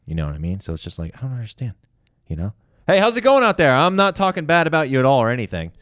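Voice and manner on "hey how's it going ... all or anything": high, loud call across the house. Real, not acted. Calling out to his friend in the other room, loud and innocent.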